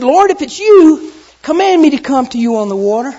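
loud, high appeal; calling out. Acted. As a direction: call across the water. Peter calls to Jesus.

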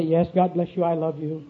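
low, soft, placid smiling reply; smiling self-control. Acted. Preacher greets the man coming to tell him off, low and smilingly calm. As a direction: answer with a hushed, practiced smile. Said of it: "I smile, I say".

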